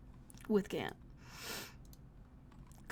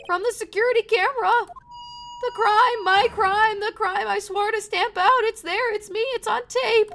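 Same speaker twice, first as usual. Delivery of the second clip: high, overwrought confession; anguished police officer; 12 semitones higher, 9 dB louder than usual. Acted. Officer confessing his crime is on tape, anguished. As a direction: pitch it high and pour out the guilt.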